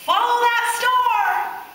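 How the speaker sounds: high, slow rallying call; rally the others to follow; slow high call of the wise men; cried out. A narrator's reading. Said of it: "The wise men cried out".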